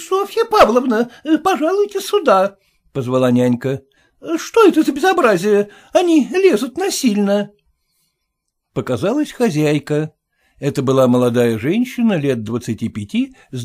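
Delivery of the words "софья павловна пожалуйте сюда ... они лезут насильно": high, loud call for help; nanny calling the mistress about intruders. Acted. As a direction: summon the mistress indignantly.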